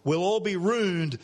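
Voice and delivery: contracted Aussie "rooned" for "ruined"; Australian slang. Shown as a demonstration. Preacher showing newcomers the contraction, Australian slang. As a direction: stretch it out in broad Australian slang. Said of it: "hear the contraction of the Australian slang".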